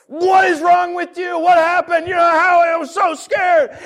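hammed-up scared parent yell; frantic yelling. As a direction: shout out fear and anger. Parent yelling at late teen.

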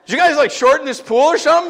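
high, loud, laughing incredulous question; mock disbelief. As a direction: ask as if the pool was shrunk. Retelling his surprise at shallow pool.

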